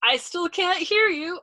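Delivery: high, slow, loud call; innocent calling back. A narrator's reading. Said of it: "Arfi called back innocently".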